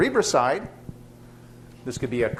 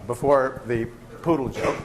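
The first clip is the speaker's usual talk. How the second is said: ordinary talk, nothing marked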